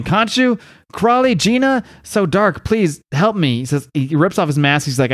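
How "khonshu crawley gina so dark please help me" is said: mock desperate cry, higher pitched; retold scream. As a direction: cry out for help without really shouting. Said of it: "he's screaming".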